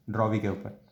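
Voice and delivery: ordinary talk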